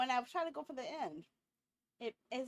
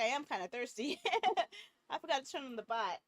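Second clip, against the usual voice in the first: ordinary delivery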